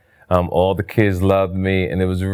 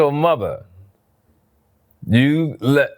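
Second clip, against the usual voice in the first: normal talking voice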